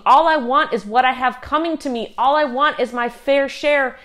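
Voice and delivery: loud and high demanding; the world screaming. Retold or imitated. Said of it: "all the world is screaming".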